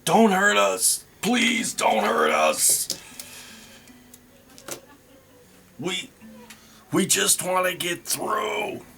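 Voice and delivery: high, panicky goblin pleading; squeaky, terrified goblin begging not to be hurt; frightened goblin in broken Common. Acted. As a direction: beg for your life like a scared goblin. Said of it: "in very broken common tongue language, the goblin blurts out".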